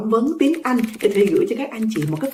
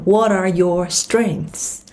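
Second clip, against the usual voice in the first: slow, "what are" linked into one; linking; much slower than usual. Shown as a demonstration. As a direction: join "what" and "are" smoothly. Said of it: "nối âm".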